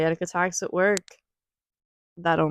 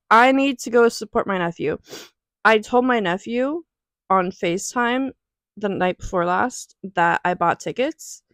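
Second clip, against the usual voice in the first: normal talking voice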